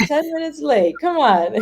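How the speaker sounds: laughing mock complaint. Retold complaint about brother.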